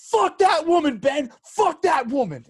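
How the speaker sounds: imitating an angry friend yelling about a woman; retold furious yell. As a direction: shout it as the raging friend. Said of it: "he is just screaming".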